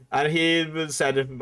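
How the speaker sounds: usual speaking voice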